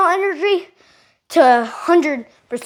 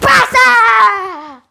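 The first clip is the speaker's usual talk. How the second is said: loud, high kiai shout; explosive all-out kick yell; full-energy kick shout; 7 semitones higher, 6 dB louder than usual. Shown as a demonstration. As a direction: shout it like the winning kick in overtime. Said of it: "Like this".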